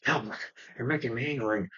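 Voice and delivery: a quiet threat before the Hulk comes out; menacing Bruce. Retold or imitated. Bruce warns Talbot after the punch, in a quiet menacing voice. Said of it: "he's like".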